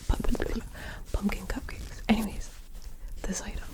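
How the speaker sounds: ordinary delivery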